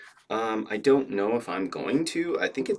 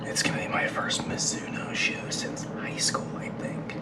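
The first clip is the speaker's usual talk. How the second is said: soft breathy whisper, not to wake anyone; hushed morning whisper; 3 semitones higher, 7 dB quieter than usual. Real, not acted. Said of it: "I'm whispering".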